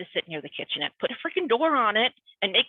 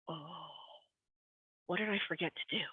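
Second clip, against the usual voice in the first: a much softer, hushed, anxious murmur; worried inner voice; 2 semitones lower, 13 dB quieter than usual. Retold or imitated. Reacting to a vague meeting request from her manager, hushed and anxious. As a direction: quietly panic to yourself. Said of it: "And I'm like".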